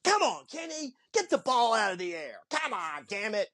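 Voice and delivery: high mock coach grumbling at the runner; frustrated coach. Coach grumbling at Kenny after the caught line drive, high mock gripe.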